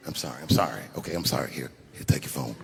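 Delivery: backpedal, quiet and embarrassed; low sheepish mumble; sheepish apology. Retold or imitated. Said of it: "like, dumb".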